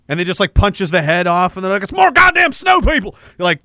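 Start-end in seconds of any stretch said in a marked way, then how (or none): 1.8-3.2 high-pitched cry of exasperation; hammed-up frustrated alarm; mock outraged shout. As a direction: yell it high as a raider who's been fooled. Acted dismay of the fooled team.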